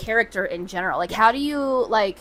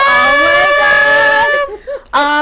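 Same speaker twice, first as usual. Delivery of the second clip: excited; 6 dB louder, much slower than usual. Real, not acted. Excited reaction to new show news.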